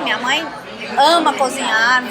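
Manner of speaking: ordinary talk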